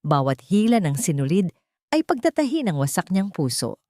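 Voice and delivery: plain reading voice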